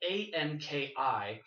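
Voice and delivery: each letter of A N K I said slowly and clearly; deliberate slow spelling. Shown as a demonstration.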